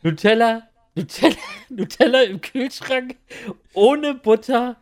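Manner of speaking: high, slow laughing outrage; laughing disbelief. Real, not acted. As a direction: repeat it, cracking up in disbelief. Wiederholt empört lachend. (lachend empört)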